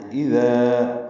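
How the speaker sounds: اذا with the final alif drawn out; اذا held for two beats; lengthened vowel. Shown as a demonstration. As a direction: stretch the long a in اذا. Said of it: "الف مدہ ہے".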